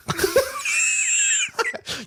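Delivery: shrieking laugh of triumph. Real, not acted. Wins quiz round.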